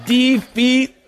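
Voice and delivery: high DE-FEET with a rising end; pun on DEFEAT. Shown as a demonstration. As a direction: repeat defeat as de-FEET, fishing for laughs. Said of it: "I thought that was great".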